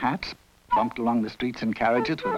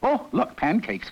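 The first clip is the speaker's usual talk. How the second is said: higher-pitched, pleased exclamation; delighted cry; 9 semitones higher than usual. A narrator's reading. Emperor spotting his breakfast pancakes, crying out in delight. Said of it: "cried the emperor".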